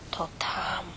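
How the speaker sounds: soft hushed whisper; the mouse points out the table in a whisper. Acted. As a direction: whisper so no one hears. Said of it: "wyszeptała myszka".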